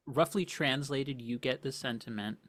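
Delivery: ordinary talk, nothing marked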